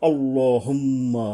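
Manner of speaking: আল্লাহুম্মা said slowly with a long humming nasal মম; slow recitation-style আল্লাহুম্মা; nasal ghunna demo. Shown as a demonstration. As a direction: draw out the মম in আল্লাহুম্মা through the nose. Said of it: "এইভাবে আর কি".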